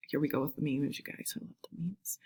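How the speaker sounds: soft whispered aside; whispering. Real, not acted. Whispered aside about memes.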